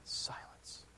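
breathy whisper of one word. Real, not acted. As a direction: let the word hang in a whisper. Dramatic pause after prayer.

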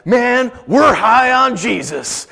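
slow, spaced-out hippie drawl, lightly voiced; Jesus hippie voice. Hippie answers are you high, slow mellow mock drawl. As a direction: stretch it out like a blissed-out hippie. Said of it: "What they'll say is something like".

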